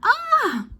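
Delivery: very high, loud shriek; startled cry. A narrator's reading. Missus Glutton shrieks as ice cream swamps her. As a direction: shriek as the cold hits. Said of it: "cried Missus G".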